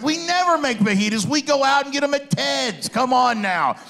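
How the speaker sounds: high, excited pushback at the idea; exasperated protest. Retold or imitated. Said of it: "And I'm like".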